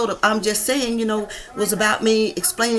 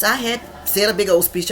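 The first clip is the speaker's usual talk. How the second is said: ordinary talk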